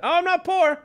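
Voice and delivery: defensive self. Retold or imitated. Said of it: "I didn't say that".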